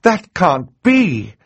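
high, slow cry of disbelief; shocked cry. Acted. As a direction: protest the news, drawn out. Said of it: "Justice Strauss cried".

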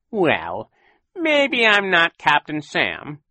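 high, slow sly villain; grudging, oily villain; Count Olaf voice. A narrator's reading. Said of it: "Count Olaf admitted".

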